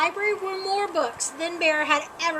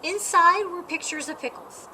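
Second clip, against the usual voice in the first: ordinary delivery, nothing marked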